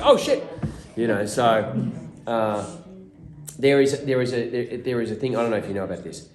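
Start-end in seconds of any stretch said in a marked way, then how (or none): 0.0-0.4 high, loud oh shit; mock dismay. Crew realising the prop is in the take, high dismay. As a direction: yelp it in horror. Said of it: "they're like".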